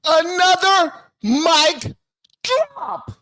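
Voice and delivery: slow, high, drawn-out yell. Real, not acted. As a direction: announce it like a triumphant hype man. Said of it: "My bad for yelling into the microphone".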